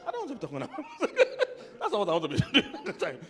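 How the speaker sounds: loud giggling mock protest as a husband; laughing squeal. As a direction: crack up mid-line. Men's view, laughing.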